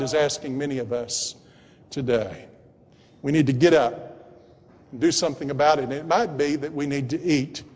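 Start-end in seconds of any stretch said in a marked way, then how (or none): none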